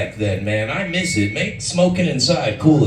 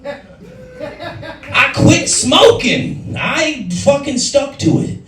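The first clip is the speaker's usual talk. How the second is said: much higher, slow and stretched, proud; slow, much higher boast about quitting smoking; triumphant boast; 13 semitones higher, 3 dB louder, much slower than usual. Acted. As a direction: crow it like a victory. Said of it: "Who's winning?".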